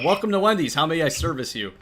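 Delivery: high, bright fast-food cashier voice; cheery drive-thru greeting. Retold or imitated. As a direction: chirp it like a drive-thru worker. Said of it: "my favorite with that was".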